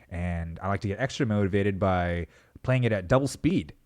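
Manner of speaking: ordinary talk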